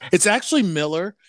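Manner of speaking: MILLER like the common surname, first syllable stressed; pronounced Miller. Real, not acted. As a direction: say Miller as MILL-er. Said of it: "it's pronounced Miller".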